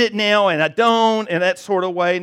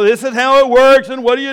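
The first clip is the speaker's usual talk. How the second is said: raised, louder know-it-all voice; mock confident boast; 6 semitones higher, 8 dB louder than usual. Preacher mocking confident church folk, smug boast. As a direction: play the smug expert sure of it all.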